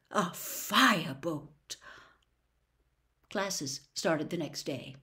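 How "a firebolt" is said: dreamy awe. Acted. Wood dreamy over broom.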